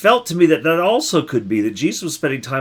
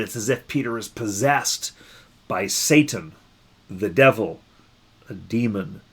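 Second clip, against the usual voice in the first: usual speaking voice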